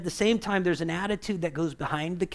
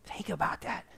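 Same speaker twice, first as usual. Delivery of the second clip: whisper; 9 dB quieter than usual. Real, not acted. Pause for reflection.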